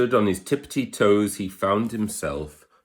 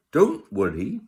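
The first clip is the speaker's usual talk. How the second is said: a hint of a peeping mouse; 6 semitones higher, 4 dB louder than usual. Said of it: "Mouse peeped".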